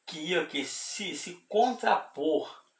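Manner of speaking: high, emphatic sermon delivery; impassioned preaching. Real, not acted. Preacher denounces the adversary to listeners, high impassioned preaching. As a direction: lift the pitch and press every phrase.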